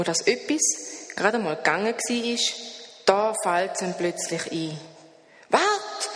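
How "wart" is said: calling out. A narrator's reading. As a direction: call after the leaving friend. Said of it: "ruft er". (er ruft dem Freund nach: Wart!)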